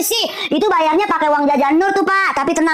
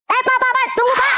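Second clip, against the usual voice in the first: high, urgent call; calling after; 8 semitones higher than usual. Acted. Child calling departing father back, urgent. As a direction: stop someone who is leaving.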